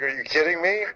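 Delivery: high-pitched, mildly stunned question; incredulous. Retold or imitated. Stunned at being pulled from game.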